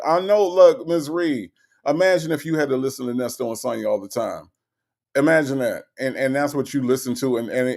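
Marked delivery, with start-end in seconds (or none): none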